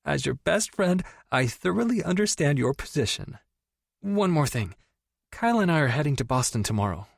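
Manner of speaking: overexcited friend teasing about the stepbrother; gushing best friend. Acted. Tori playing formal then thrilled.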